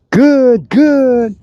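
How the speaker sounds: dog praise marker. Real, not acted. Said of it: "I'm chattering so much with good".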